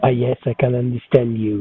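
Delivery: normal talking voice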